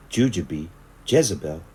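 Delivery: usual speaking voice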